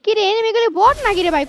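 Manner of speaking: loud, high alarmed yell; alarmed shout. Real, not acted. Shouting during firefight.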